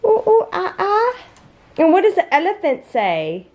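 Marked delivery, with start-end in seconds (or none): 0.0-1.2 high playful monkey noises; ooh-ooh-ah-ah like a monkey for a baby; monkey call. Retold or imitated. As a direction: mom doing monkey sounds for her toddler. Mom imitates monkey for toddler.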